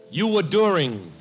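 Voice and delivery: ordinary talk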